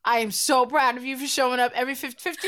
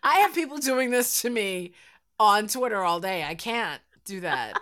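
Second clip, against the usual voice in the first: ordinary talk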